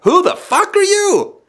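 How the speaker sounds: high, slow, shocked who-are-you; startled outrage. Retold or imitated. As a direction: pitch it way up in shocked disbelief. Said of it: "And you'd be like".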